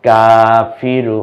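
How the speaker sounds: Shahida stretched syllable by syllable, each vowel held long; slow drawn-out reading. Shown as a demonstration. Teacher to learners, showing the joined word, very slow. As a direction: draw out Shahida slowly and clearly. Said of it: "এবার মেয়েটাকে জিজ্ঞেস করেন".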